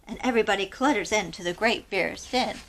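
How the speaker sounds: ordinary delivery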